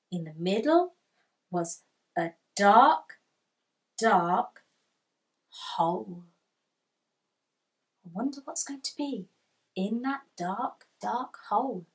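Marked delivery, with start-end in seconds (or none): none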